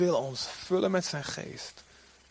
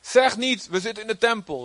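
prophet's warning; 7 semitones higher than usual. Acted. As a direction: call it out like Jeremiah warning the people. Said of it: "Dat zei Jeremia". (hoge, luide profetenstem)

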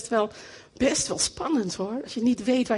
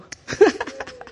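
delighted laugh; 8 semitones higher, 4 dB louder than usual. Real, not acted. Laughs at her own point.

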